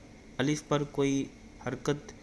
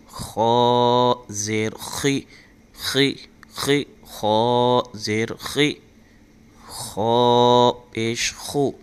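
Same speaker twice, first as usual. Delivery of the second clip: louder, very slow chanted syllables; Qaida recitation drill; 11 dB louder, much slower than usual. Shown as a demonstration. Teacher drilling students in kha and dal, loud chant. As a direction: proclaim each syllable fully for pupils to echo.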